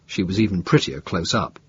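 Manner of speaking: usual speaking voice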